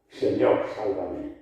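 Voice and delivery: normal talking voice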